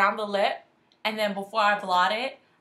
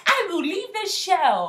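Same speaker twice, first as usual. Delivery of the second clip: mocking quote; 8 semitones higher, 4 dB louder, slower than usual. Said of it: "she's like".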